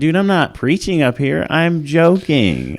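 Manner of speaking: high, slowed exasperated protest. Retold or imitated. Comedian defending joke to critics.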